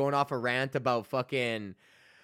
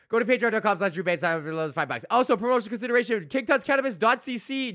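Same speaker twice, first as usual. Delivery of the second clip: higher, louder hyped sponsor plug; podcast ad read; 7 semitones higher, 4 dB louder, faster than usual. Acted. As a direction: sell the Patreon like a radio host.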